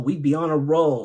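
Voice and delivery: normal talking voice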